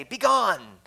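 hammed-up order to go away; mock commanding dismissal. Preacher mocking men banishing the woman, in a mock command.

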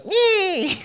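a very high squeaky robot noise; high robot squeal. Retold or imitated. Preacher imitating a toy robot head popping up again, high squeal. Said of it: "the dude's head goes".

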